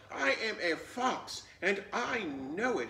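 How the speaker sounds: mildly loud, high insistent shout; a hint of an indignant yell. A narrator's reading. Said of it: "he shouted".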